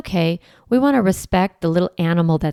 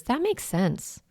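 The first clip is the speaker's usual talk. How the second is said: higher, much softer and slow; soothing reassurance; 11 semitones higher, 7 dB quieter, slower than usual. Shown as a demonstration. Parent comforting an upset child, soft, higher and slow. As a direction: coo it to a distressed child. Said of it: "one of my favorite phrases".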